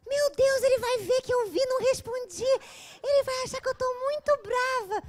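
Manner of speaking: loud high panicky squeal; panicked friend. Retold or imitated. Friend panics about reply.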